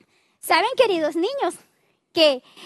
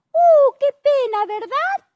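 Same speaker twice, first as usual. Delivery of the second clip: high, loud exaggerated "what a pity"; mock pity; 8 semitones higher, 5 dB louder than usual. Teller to the children, sorry for the servant, high and loud. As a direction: gasp out the pity for the kids.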